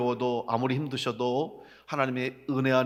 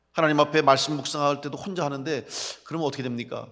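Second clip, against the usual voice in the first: usual speaking voice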